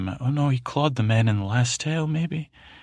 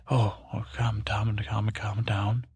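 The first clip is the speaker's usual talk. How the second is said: soothing hush. Acted. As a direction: settle the frightened boy. Cat soothing a frightened Tommen.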